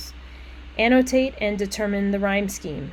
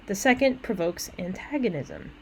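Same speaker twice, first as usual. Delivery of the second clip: ordinary talk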